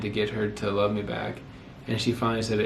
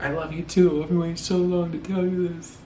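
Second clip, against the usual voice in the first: high, thrilled reply; retold thrill, pitched high; excited; 7 semitones higher than usual. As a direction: blurt it out, overjoyed. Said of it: "I was super excited".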